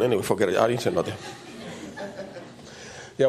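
ordinary talk

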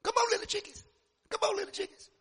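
high clucking mama call; mother hen calling. Acted. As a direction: call the chicks like a fussy hen.

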